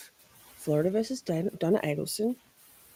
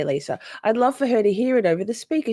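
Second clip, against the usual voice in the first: ordinary talk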